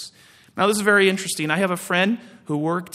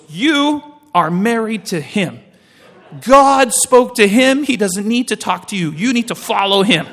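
stern uncle lecture; 3 semitones higher, 6 dB louder than usual. Retold or imitated. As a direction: lay down the law, word by word. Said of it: "He goes".

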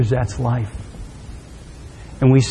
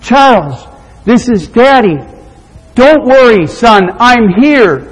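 loud, raised, reassuring call; 10 semitones higher, 7 dB louder than usual. Acted. Daddy calling up to his trapped son, urgent reassurance. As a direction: project it upward to someone far away.

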